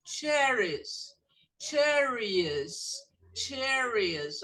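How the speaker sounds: cherries with a crisp ch at the start; English ch. Shown as a demonstration. Teacher drilling cherries for the class, clear ch. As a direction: hit the ch in cherries. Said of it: "aqui, ó, ch".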